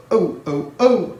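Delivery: rising cry of oh; trucks crying out. A narrator's reading. Silly trucks bumping, rising cries. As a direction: squeal like jolted trucks. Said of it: "they cried again".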